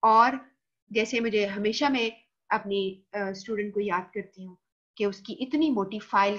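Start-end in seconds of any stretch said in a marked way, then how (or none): none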